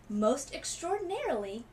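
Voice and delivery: put-on doctor amazed at the striped girl; exclaiming. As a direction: marvel at it like a puzzled doctor. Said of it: "he exclaimed".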